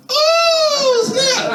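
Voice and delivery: very high shriek; mock shocked squeal. To the girl, a very high surprised shriek.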